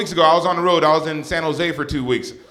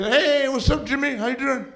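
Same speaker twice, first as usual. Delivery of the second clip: much higher sing-song mom greeting; friendly mom; 12 semitones higher than usual. Retold or imitated. Mom greeting the show host on the phone, high and sweet. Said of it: "she knows Jimmy".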